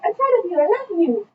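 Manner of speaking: high, cooing mock-parent praise; put-on affection. Fake dad gifting a painting to Kai, put-on affection.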